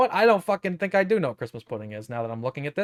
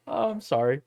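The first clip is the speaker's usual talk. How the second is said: normal talking voice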